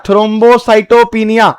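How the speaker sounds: thrombocytopenia drawn out very slowly, syllable by syllable; slow sounding out. Shown as a demonstration. Teacher to students, sounding out thrombocytopenia very slowly. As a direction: stretch thrombocytopenia out so every syllable is heard. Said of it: "teacher sounds out the new term".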